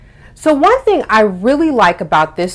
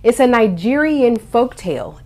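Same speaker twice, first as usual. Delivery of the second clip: usual speaking voice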